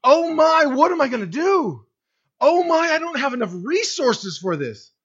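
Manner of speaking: loud, high, hammed-up fretting; mock panic. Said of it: "we go".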